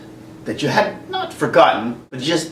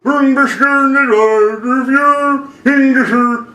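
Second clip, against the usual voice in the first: slow, louder, higher garbled Swedish Chef singing; mumble-sing like the Muppets' Swedish Chef; 8 semitones higher, 4 dB louder, slower than usual. Pretend. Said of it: "Why do I sound like the Swedish chef?".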